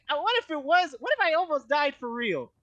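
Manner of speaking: high, loud, incredulous rant; indignant outrage. Real, not acted. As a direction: demand it, still amazed. Outraged at paramedics leaving.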